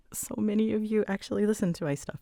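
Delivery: ordinary delivery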